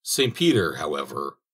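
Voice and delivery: plain reading voice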